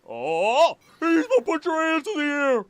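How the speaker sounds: high, loud startled yell; excited scream. Real, not acted. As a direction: yell out in shock. Screams at game surprise.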